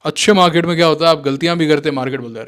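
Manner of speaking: ordinary talk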